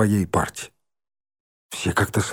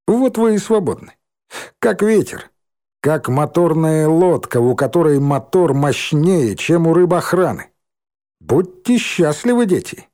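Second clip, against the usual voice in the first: higher, hammed-up benevolent tone; mock blessing; 5 semitones higher than usual. A narrator's reading. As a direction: grandly release the kids.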